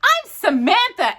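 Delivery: put-on high, bright girl's voice; Samantha. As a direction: announce yourself proudly.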